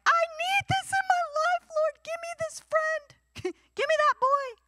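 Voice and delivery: put-on very high, childish whine with a laugh breaking in; whiny pleading child. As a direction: beg God like a spoiled kid wanting a treat. Congregation whining to God.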